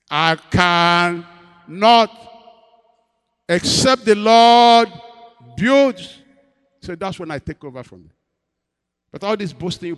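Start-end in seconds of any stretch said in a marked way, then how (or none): 0.1-6.2 very slow, loud and ringing; drawn-out proclamation. Acted. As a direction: stretch every word out for effect. Drawn-out climax of the point.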